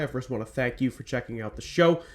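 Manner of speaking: ordinary talk, nothing marked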